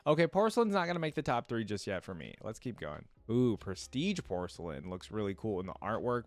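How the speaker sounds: ordinary talk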